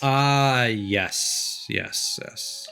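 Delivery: ordinary talk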